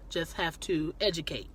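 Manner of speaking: ordinary talk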